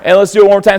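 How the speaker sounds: ordinary talk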